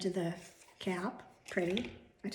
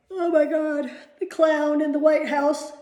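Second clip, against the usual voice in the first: exclaiming; 6 semitones higher, 10 dB louder, slower than usual. Real, not acted. Mocking the president.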